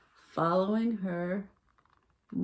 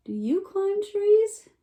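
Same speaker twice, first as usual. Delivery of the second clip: higher, slow squeaky question; little raccoon asking; 12 semitones higher, 6 dB louder, slower than usual. A narrator's reading. Raccoon repeating his question to the fawn, high and slow. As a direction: stretch it out in the critter voice. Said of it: "said the little raccoon".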